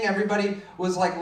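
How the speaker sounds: ordinary talk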